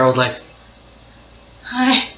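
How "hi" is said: high, timid little greeting; shy awkward hi. Retold or imitated. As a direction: mimic your shy teenage self saying hi. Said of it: "this is really how I talked with her".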